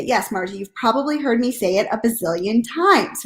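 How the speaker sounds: normal talking voice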